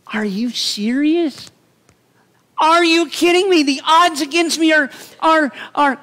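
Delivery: a stunned man who can't believe his ears; incredulous Gideon. Retold or imitated. Gideon protesting to God that the army is too small, high and loud.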